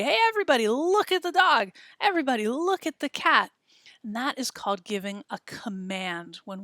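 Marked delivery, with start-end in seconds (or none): 0.0-3.5 high, loud call, a bit fast; calling everybody. Retold or imitated. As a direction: grab everyone's attention. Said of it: "just kinda everybody".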